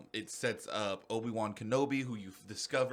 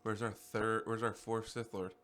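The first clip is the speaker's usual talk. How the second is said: normal talking voice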